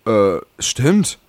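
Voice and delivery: ordinary talk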